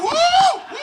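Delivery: high, loud mocking whoop; hammed-up audience cheer; mock audience yell. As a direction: imitate the crowd's painful woo. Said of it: "Some of you yell".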